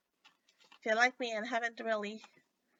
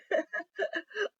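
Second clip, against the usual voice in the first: relieved laugh; 8 semitones higher than usual. Real, not acted. Relief that the sound works.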